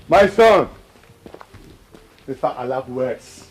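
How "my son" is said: loud, high overjoyed call; father loudly welcoming his son back; joyful greeting. Acted. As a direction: greet him like a lost child come home.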